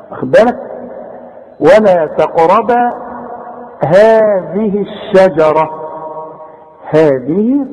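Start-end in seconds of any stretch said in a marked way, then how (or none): 1.6-5.7 ولا تقربا هذه الشجرة slowly, long vowel in هذه; measured recitation. Shown as a demonstration. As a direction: stretch هذه two counts, keep letters distinct. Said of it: "عشان تمدها حركتين".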